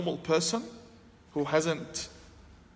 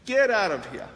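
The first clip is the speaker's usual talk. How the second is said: louder, higher get out of here, shooing him off; shouted rejection; 5 semitones higher, 5 dB louder than usual. Retold or imitated. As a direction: bark it at the stranger to make him leave. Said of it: "Literally, this was my reaction".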